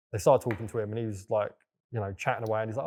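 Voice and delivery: ordinary delivery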